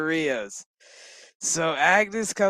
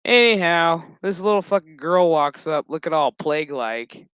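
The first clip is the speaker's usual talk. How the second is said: ordinary delivery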